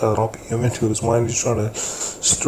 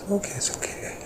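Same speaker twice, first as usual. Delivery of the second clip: whispering; 13 semitones higher, 6 dB quieter than usual. Retold or imitated. Soothing someone who just got upset.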